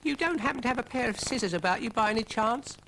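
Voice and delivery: high polite snail voice; a squeaky snail asking nicely; Brian the snail. Acted. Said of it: "asked Brian".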